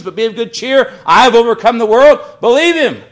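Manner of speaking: rising, loud preaching, each "believe him" punched; fervent preaching. Acted. Pastor urging his congregation to trust Scripture, fervent preaching. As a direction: drive it home to the congregation.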